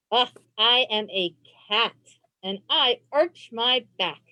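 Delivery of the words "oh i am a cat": usual speaking voice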